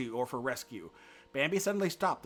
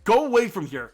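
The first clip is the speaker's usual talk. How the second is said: loud, high angry command; cross order; 7 semitones higher, 11 dB louder than usual. A narrator's reading. Karas warning Bambi away from Feline, crossly. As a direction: order him off, threatening. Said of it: "Karas ordered him crossly".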